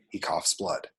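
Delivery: normal talking voice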